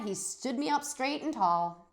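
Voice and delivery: ordinary delivery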